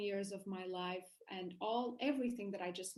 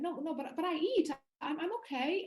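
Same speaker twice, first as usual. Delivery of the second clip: insistent protest; 6 semitones higher, 5 dB louder than usual. Retold or imitated. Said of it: "arguing with them".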